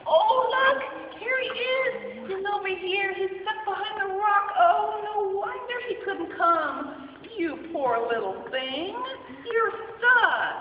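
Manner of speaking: high, loud happy finding; delighted to find it; relieved excited shepherd; excited discovery. Acted. Shepherd finds lost sheep.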